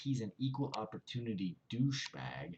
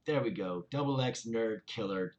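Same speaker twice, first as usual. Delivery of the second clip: ordinary talk, nothing marked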